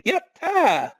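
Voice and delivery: very high gleeful shout; triumphant cheer. Acted. Kimiyawa celebrating the fallen guard, very high. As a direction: crow over the win.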